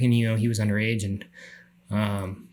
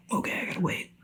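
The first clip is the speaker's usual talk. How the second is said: whispered and higher, holding it together; whispered self-talk; 8 semitones higher, 10 dB quieter, faster than usual. Retold or imitated. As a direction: whisper to yourself underwater. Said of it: "I'm like".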